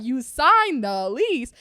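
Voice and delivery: high, loud, drawn-out mock disbelief; slow, incredulous screech-up in imitation; mom freaking out. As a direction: imitate an appalled mom, stretching each word. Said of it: "she was freaking out".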